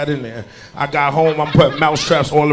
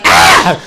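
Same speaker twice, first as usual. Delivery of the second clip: 1 mock scream; 7 dB louder than usual. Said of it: "She was like".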